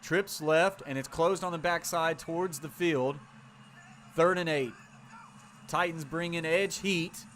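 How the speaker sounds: brisk play-by-play call; live play-by-play. Acted. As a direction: call the snap like a broadcaster. Streamer calls the play live.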